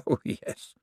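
breathy mad chuckle; gloating laugh. Acted. Cosmo gloats to himself, whispered laugh. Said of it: "mad gloating thought".

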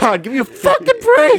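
high angry imitation. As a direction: squeak it out like he lost it. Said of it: "Your voice is getting all high".

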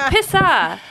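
high, loud stab at Boston "Pissa"; Boston accent attempt. Shown as a demonstration. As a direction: say "Pissa" with an open Boston "ah" ending. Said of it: "translating this from the Boston accent".

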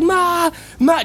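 high mock scream for Mom; sister screaming. Sister running to the kitchen, high mock scream for Mom. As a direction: shriek for Mom, imitated. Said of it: "just screaming".

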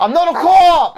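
high, loud attempt at Boston; bad Boston accent. Host mocking an actor's failed accent, bad loud Boston. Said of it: "I can't do a Boston accent".

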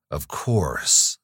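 plain reading voice

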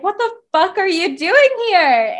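retold startled challenge; acted outrage. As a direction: confront them in mock disbelief. Acting heroine confronting her returning lover.

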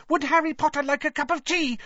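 very high squeaky elf; Dobby, eager squeak. A narrator's reading. House-elf offering Harry tea over sobbing, high squeak. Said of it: "he squeaked loudly over Winky's sobs".

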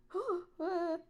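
startled gasp. Real, not acted. Jiggled equipment, startled.

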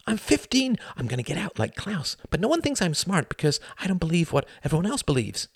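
put-on high, worked-up teenage complaint; impassioned protest. A narrator's reading. Fifteen-year-old tells Tom nobody takes him seriously, impassioned protest. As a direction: plead your case with pent-up frustration.

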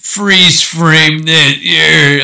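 loud, high, drawn-out cheesy quip; mock one-liner. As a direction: stretch it like a corny action hero. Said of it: "hate those one-liners".

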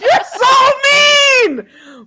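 high, loud, slow through laughter; laughing protest. Real, not acted. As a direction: protest while laughing hard. Teased by friends, laughing.

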